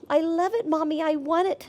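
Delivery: put-on high, loud squeal of a little girl; squealing child. A narrator's reading. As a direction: gush like a kid who wants the puppy. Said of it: "Abby squealed".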